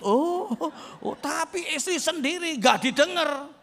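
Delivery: a neglected wife wailing for show; mock whimpering. Preacher mocks a neglected wife's lament, hammed-up cry.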